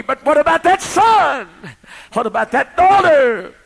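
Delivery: loud, high fervent preaching; full-force sermon cry. Real, not acted. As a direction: call out to the congregation with rising fire.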